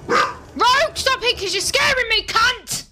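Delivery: high frightened shout; scared yelling. Real, not acted.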